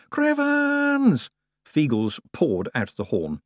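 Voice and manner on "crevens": very high, loud war cry; battle cry. A narrator's reading. Feagles cry out as they pour from the horn, high loud cry. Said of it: "With a cry of".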